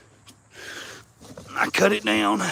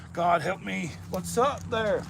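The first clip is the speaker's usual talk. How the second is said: ordinary talk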